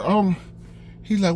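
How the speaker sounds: ordinary talk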